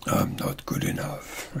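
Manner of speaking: low, hushed, near-whispered voice; low pitch voice. Shown as a demonstration. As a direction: drop it down low and quiet. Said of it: "with a low pitch voice".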